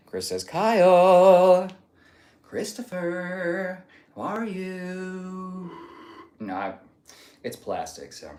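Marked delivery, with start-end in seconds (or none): 0.5-5.7 high, held notes on each word; drawn-out greeting. Acted. Drawn-out greeting read from chat.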